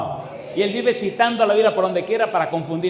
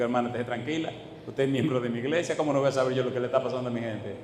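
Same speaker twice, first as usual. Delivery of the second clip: soft, lower, calming tone; reassuring pastor; 5 semitones lower, 6 dB quieter, much faster than usual. Retold or imitated. Pastor soothes the worried sister, softly reassuring. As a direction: settle her fears gently.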